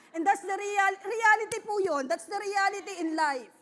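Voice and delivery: loud, high emphatic preaching. Real, not acted. Preacher to congregation, loud and high-pitched. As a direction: belt it out like a pulpit climax.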